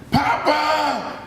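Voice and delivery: high, long-held imitated yell; screamed call. Father calling to grandfather over roaring propellers, screaming. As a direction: holler it over the noise of propellers. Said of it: "He starts screaming".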